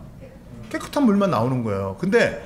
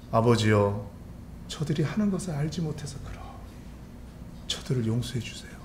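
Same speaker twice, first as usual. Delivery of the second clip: soft, hushed prayer of Jesus; low-key, intimate plea to the Father; tender prayer; 3 semitones lower, 7 dB quieter than usual. Acted. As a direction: pray quietly, with pained forgiveness. Preacher voices Jesus praying gently.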